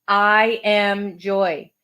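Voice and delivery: very slow, evenly weighted affirmation; drawn-out, deliberate reading; slow, equal emphasis. Shown as a demonstration. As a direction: give each word the same weight, slowly. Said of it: "say it very slowly, giving equal emphasis".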